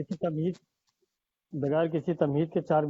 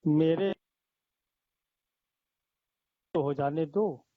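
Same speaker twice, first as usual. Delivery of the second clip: poetry recitation. Acted. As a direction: linger on each line for the audience. S2 recites verses at mushaira.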